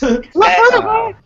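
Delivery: high and loud punch; emphatic. Acted. Telling the boys she had no idea: loud, high punch. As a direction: bark it out high.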